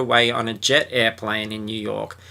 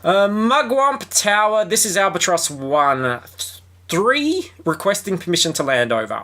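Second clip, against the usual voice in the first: pilot on the radio; 7 semitones higher than usual. Pretend. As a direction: play a pilot calling the tower.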